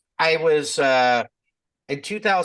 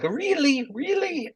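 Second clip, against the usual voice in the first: mock surprise; 14 semitones higher, slower than usual. Son imitating his father's astonished reply, high mock disbelief. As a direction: imitate dad's disbelief, pitched way up.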